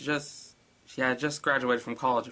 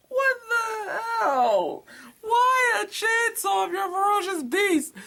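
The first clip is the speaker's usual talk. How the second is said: very high, loud, slow outrage; hammed-up fake-nail panic; shrieking disbelief; 12 semitones higher, 4 dB louder, slower than usual. Real, not acted. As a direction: shriek at the absurd plot. Ranting at chainsaw-wielding beast.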